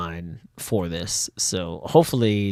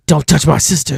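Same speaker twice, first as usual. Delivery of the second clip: higher, louder defiant warning; protective threat; 6 semitones higher, 7 dB louder than usual. Acted. Sarah warns the attacker off her sister, loud. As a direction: warn him off your sister.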